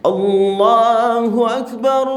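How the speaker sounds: slow high loud Allahu Akbar rising on Akbar; loud but nice takbir. Shown as a demonstration. Preacher showing a loud beautiful takbir, loud. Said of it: "keras, boleh, tapi bagus".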